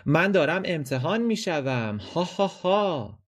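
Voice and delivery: high, mocking student laugh; mock the exam with a cackle; laughing loudly.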